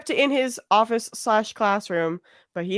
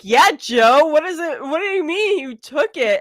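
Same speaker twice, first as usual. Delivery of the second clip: mock accusation; 6 semitones higher, 4 dB louder, slower than usual. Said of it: "and I'm like".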